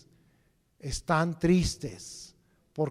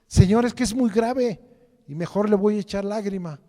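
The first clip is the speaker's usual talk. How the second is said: a louder, higher plea from a worrier, pushed fast; mock the believer who insists on crying; pleading believer; 4 semitones higher, 6 dB louder than usual. Believer answering the Lord, plea raised louder and higher.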